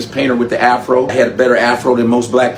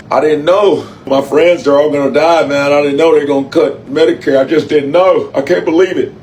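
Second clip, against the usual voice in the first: mock distraught voter. Imitating a rural voter complaining to the camera, mock pleading. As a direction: ham up a panicked voter on camera. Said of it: "Now you in the camera like".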